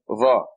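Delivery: Va with the tongue stuck out; heavy Z with the tongue between the teeth; tongue sticking out. Shown as a demonstration. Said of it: "you stick your tongue out".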